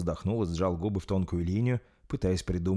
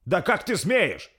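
slow, high, loud outrage; indignant; 11 semitones higher, 5 dB louder, slower than usual. A narrator's reading. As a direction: bristle at the insolence. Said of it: "возмутился Рольф". (возмущённо, повысив голос)

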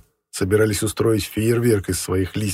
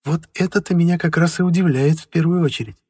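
wife voice; 9 semitones higher, 5 dB louder than usual. A narrator's reading. Wife speaks.

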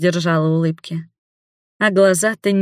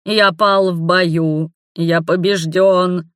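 slow hoarse theatrical lament; hammed-up fallen warrior; 3 dB louder, much slower than usual. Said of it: "прохрипел он".

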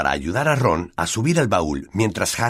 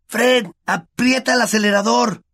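much higher, urgent yell; shouting; 4 dB louder than usual. Acted. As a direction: call to the driver to floor it. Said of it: "Ron gritó".

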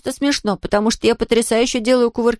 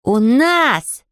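indignant shout. A narrator's reading. Boys quarrel over hotels.

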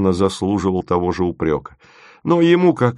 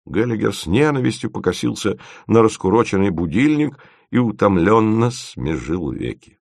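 ordinary delivery, nothing marked

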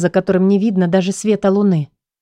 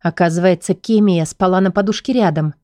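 plain reading voice

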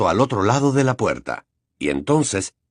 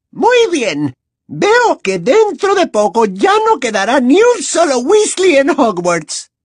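high, slow, sugary gloating; gloating villain; 6 dB louder, slower than usual. Acted. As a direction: savor the victory primly. Umbridge gloats.

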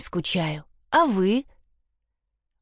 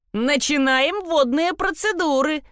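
hollered; 9 semitones higher than usual. A narrator's reading. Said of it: "проголосил Стив".

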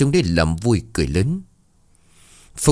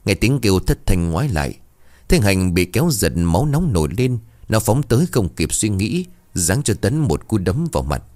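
plain reading voice

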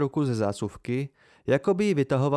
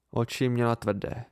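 ordinary delivery, nothing marked

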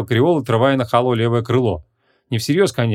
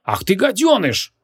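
slow, higher angry snap; indignant bark; 9 semitones higher, slower than usual. A narrator's reading. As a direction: bark it out at the pest. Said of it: "возмущённо рявкнул маг".

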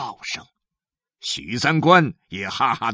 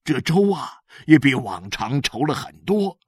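raised, excited husband's voice; lightly acted happy amazement; delighted husband; 6 semitones higher, 4 dB louder than usual. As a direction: marvel at the wife's surprise, starting high and loud. Voices husband moved by surprise.

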